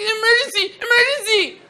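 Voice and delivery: put-on very high, loud squeal of a panicking kid; little skinny kid's voice. Little kid running from his cabin, high shrieking imitation. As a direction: imitate a small child shrieking for help. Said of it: "his voice was like that".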